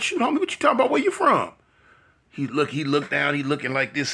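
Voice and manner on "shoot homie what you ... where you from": high, incredulous demand; shocked disbelief. Retold or imitated. To Peanut after hearing he switched sets, high shocked disbelief. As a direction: push back in shocked disbelief. Confronting friend about his gang.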